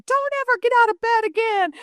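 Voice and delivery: high-pitched mock yell; retold exasperated scolding. Mom scolds crying son after the mess, high mock yell. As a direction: act out a mom at the end of her rope.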